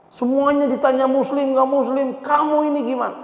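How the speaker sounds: high, exasperated father, retold; angry. Father snapping at the agent over the question, angry. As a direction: take them to task in a high pitch. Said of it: "Yang marah bapaknya".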